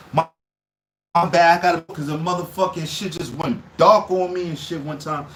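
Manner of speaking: ordinary delivery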